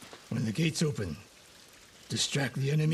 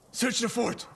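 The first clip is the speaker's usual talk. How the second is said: high, urgent command; urgent order; 10 semitones higher than usual. Acted. Sensei on finding student's pendant, urgent called order. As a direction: call the order out across the fort.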